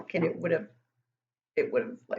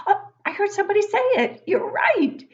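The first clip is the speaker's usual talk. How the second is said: excited; 13 semitones higher, 9 dB louder, faster than usual. Real, not acted. Answering a child's guess excitedly.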